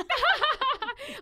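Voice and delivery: high, loud burst of laughter; loud, high cackle; acted laugh. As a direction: let out a high laugh as a retold reaction. Acts out reaction to Morgan.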